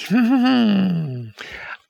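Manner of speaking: high, slow evil cackle. A narrator's reading. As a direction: cackle like a wicked witch. Witch laughs, leaving for tea.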